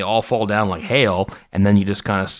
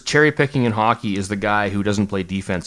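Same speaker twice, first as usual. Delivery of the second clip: ordinary delivery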